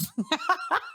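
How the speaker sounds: high-pitched burst of laughter; laughing. Real, not acted. Joking he took two years to find her attractive, laughing. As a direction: crack up at your own joke.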